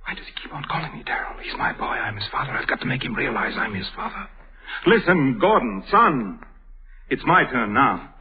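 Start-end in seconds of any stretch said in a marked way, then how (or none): 0.1-4.3 hushed, quick, pained inner aside; whispered anguished thought. Acted. Father privately desperate to be known as the son's father, whispered. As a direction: whisper it urgently to yourself.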